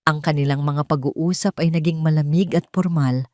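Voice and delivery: ordinary delivery, nothing marked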